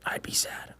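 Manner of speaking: soft whispered thought. Retold or imitated. Owner whispering about shooting his dog. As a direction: breathe it to yourself. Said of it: "I was like".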